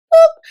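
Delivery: squeaky high alien boop; high loud cartoon boop. Alien sound effect.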